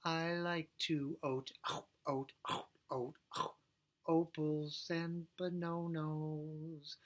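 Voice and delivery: "eat" sung as "oat", "bananas" as "bononos"; O vowel swap. Shown as a demonstration. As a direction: sing every vowel as O. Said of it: "So we're gonna do the O sound".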